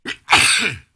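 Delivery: sudden loud choking cough; hacking after drink went down wrong pipe; coughing up water. Real, not acted. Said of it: "took a drink and went down the wrong pipe".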